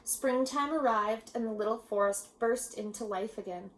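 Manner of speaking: ordinary delivery, nothing marked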